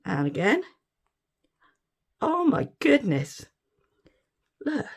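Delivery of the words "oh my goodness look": normal talking voice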